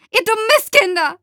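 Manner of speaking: very high, loud screeched insult; furious screech. A narrator's reading. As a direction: screech it at the children in rage. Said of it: "kreische ich".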